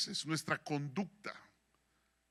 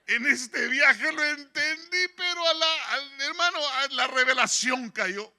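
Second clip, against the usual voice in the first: loud, higher, slow emphatic preaching; preaching at full force; 8 semitones higher, 10 dB louder than usual. Real, not acted. Preacher telling the congregation his revelation, fervent. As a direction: hammer home the revelation.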